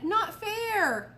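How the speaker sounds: put-on high child's holler of protest. A narrator's reading. Daniel hollering about losing bikes. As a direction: cry out that it's unfair. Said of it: "Daniel hollered".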